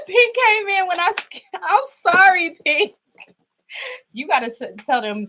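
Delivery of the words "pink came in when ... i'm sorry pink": shaken. Real, not acted. Still shaken after the scare.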